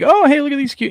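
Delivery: very high, loud gushing; mock cooing delight. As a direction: act out an innocent viewer's delight. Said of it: "You think".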